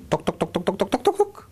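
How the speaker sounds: soft, rising toc toc taps; stick tapping sounds. Retold or imitated. Imitating the stilts crossing, soft rising taps.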